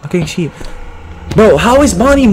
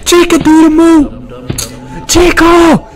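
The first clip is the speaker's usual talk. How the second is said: urgent yell; 7 semitones higher, slower than usual. Real, not acted.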